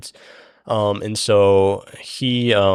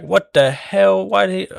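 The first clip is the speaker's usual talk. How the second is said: shocked outburst; 11 semitones higher, 5 dB louder than usual. Retold or imitated. Crew finds Mateo on the bed, high shocked outburst.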